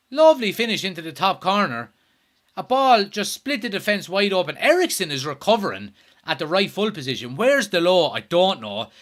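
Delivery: worked-up football commentator, voice lifted; live play-by-play. Real, not acted. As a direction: talk through the goal with loud exasperation.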